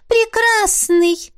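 high, slow, dreamy ghost voice; Missy swooning over her beloved; dreamy correction. Acted. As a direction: savour the word like a crush. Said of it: "исправила её Мисси".

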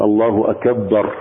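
الله أكبر said slowly with the ba of أكبر doubled. Shown as a demonstration. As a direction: hit a geminated باء in الله أكبر, slowly. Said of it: "يشدد الباء". (الله أكبر ببطء مع تشديد الباء)

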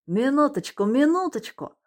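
high, anxious pleading; panicky, trying to stop her; flustered. Acted. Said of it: "заволновался Ромка".

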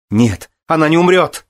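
high, loud cry of denial; exclaimed protest. A narrator's reading. Son refusing to accept his mother's death, exclaiming. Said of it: "воскликнул он".

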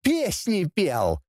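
high, drawn-out bratty announcement; gleeful grunt. A narrator's reading. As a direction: proudly needle him, stretching the words. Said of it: "хрюкнул его сын". (ехидно, протяжно, высоким голосом)

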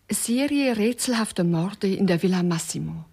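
normal talking voice

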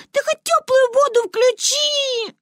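put-on high, slow childish plea; pleading girl. A narrator's reading. Girl begging grandmother for warm water. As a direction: beg like a little girl.

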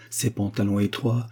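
ordinary delivery

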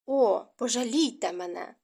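a mild weary moan, lightly voiced. A narrator's reading. Marquise with a headache begging visitors to leave, mild moan. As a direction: plead to be left alone with a touch of a groan. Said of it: "простогнала Анжеліка".

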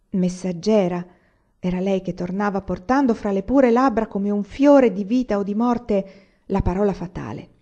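plain reading voice

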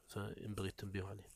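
ordinary delivery

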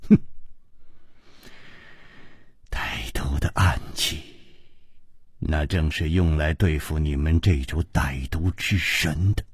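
low, soft, half-breathed reply; unhurried, sighing. A narrator's reading. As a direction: let it drift out quietly after a sigh. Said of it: "悠悠地说". (低声悠悠地说)

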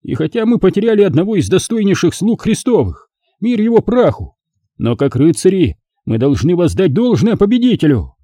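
high-pitched ceremonial declamation; solemn magister's oration. Acted. Magister honouring a fair duel before the knights, solemn oratory. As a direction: address the hall grandly, each phrase proclaimed.

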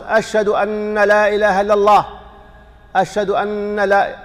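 أن said with a heavy doubled nun, slowed; doubled nun, wrong. Shown as a demonstration. Teacher showing a common adhan mistake. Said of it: "يشدد النون".

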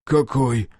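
usual speaking voice

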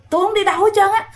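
insistent protest. Retold or imitated. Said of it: "cô Lệ mới cãi lại". (lặp lại lời từ chối, giọng cao vút)

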